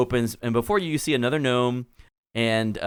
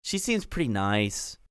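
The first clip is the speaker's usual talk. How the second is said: put-on valley girl gnome; 8 semitones higher than usual. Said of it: "Clotilda says".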